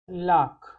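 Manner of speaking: लाख said as French lac, a long open "laakh"; French pronunciation. Shown as a demonstration. Teacher demonstrating French lac to students, careful pronunciation. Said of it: "pronounce कैसे करते हैं?".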